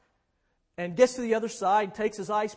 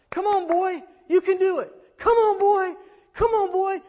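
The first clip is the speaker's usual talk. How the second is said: high, loud urging call; 10 semitones higher, 8 dB louder than usual. Acted. Muir coaxing his dog down the ice, calling out. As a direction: call out loudly to coax a dog along. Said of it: "So he starts calling out".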